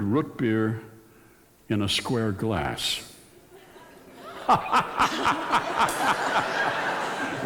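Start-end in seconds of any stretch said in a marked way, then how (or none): 4.3-7.4 high burst of laughter. Real, not acted. Laughs at own math joke.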